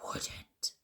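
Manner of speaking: a dry, breathless croak in a whisper; stunned, voiceless croak. A narrator's reading. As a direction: croak it out, shocked and barely voiced. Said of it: "I croaked".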